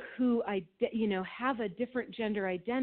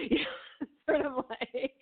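laughing; 5 semitones higher, slower than usual. Real, not acted. Laughs while retelling the joke.

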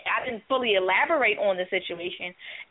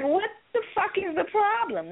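pitched up, exasperated demand; mock frustrated demand, higher; 8 semitones higher than usual. To her sulking partner, pitched-up exasperated demand.

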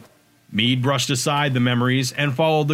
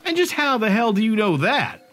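demanding; 9 semitones higher than usual. A narrator's reading. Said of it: "Meade demanded".

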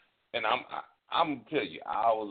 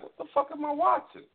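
horrified disbelief; 8 semitones higher than usual. Retold or imitated. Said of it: "You know, like".

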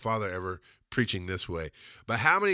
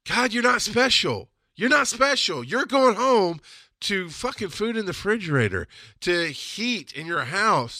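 heated rant; 8 semitones higher than usual. Real, not acted. Comic berating people who give to feel better, heated rant. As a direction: scold them off their high horse. Ranting at self-congratulating givers.

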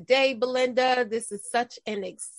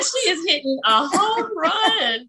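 excited; 8 semitones higher than usual. Retold or imitated. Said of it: "I was like".

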